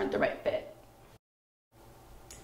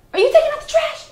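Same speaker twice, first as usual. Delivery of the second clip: shocked accusation; 7 dB louder, faster than usual. Retold or imitated. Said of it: "She was like".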